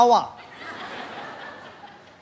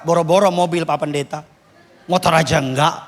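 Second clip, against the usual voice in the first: a lower, drawling churchgoer voice; mock poor congregant; 11 semitones lower than usual. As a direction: play the humble old man, low. Jemaat miskin menjawab pendeta. (suara bapak jemaat yang rendah)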